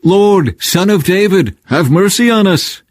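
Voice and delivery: a mild read-aloud cry for help; narrated shout. Acted. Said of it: "they began shouting".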